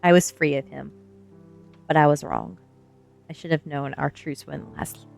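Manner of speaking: ordinary delivery, nothing marked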